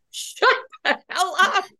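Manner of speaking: high-pitched mock hiss, laughing through it; half-laughing disbelief at husband; laughing exasperation. Wife silently scolding husband who took over, laughing. Glaring at husband hogging conversation.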